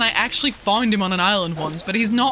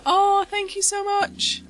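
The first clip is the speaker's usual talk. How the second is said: gushing thanks; 6 semitones higher, slower than usual. Real, not acted. Thanking chat for kindness.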